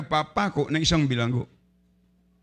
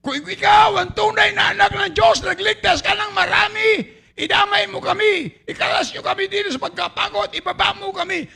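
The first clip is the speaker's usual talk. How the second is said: much higher, loud pleading yell from the cross; desperate shout; 13 semitones higher, 3 dB louder than usual. Acted. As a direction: cry out in mocking desperation. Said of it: "anong sigaw niya?".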